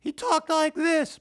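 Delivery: put-on very high, drawn-out squeaky kid voice; Brian's voice. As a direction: mimic a boy's absurdly high-pitched voice. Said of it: "Brian's voice".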